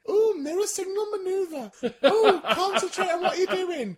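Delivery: hammed-up fretful driving instructor; fussy nagging passenger. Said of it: "he'd have me in my car going".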